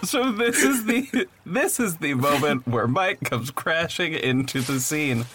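ordinary talk

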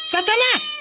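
a single high called name; calling the cat. Real, not acted. Host calls the crying cat by name, high call. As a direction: call the pet up high and bright.

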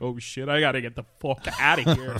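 loud, hurried imitation of alarm; mock panicked retreat. Prime minister fleeing angry constituents, mock panic. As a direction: blurt it out as a politician suddenly desperate to flee. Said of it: "what was his facial expression".

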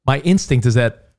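ordinary talk, nothing marked

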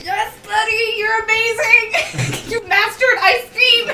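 drawn-out, delighted praise; gushing cheer. Acted. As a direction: gush over your victorious buddy. Cheering on the winning creature.

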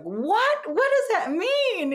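high, slow stunned question; shocked disbelief. Retold or imitated. Caught off guard by the psychic, stunned and high. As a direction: ask it like the floor just dropped.

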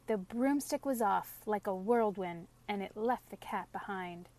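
ordinary delivery, nothing marked

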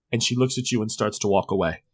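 ordinary talk, nothing marked

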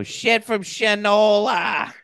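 high, slow folksy drawl. Retold or imitated. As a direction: draw it out like an old Mainer. Stephen King character voice.

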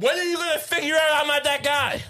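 high-pitched, hammed-up frustration; mock exasperation. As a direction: plead with a dog that won't learn. Acted owner pleading with the dog.

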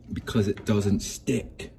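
lightly done English chef impression; a hint of an English chef, punched out; Gordon Ramsay. Quoting a chef on nonstick pans, light English chef impression. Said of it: "to quote Chef Gordon Ramsay".

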